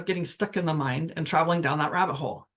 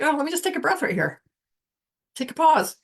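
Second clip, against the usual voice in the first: higher, quick and bright self-reminder; light inner voice; 8 semitones higher, 4 dB louder than usual. Pretend. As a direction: cheerfully remind yourself to pause. Voices herself pausing to breathe.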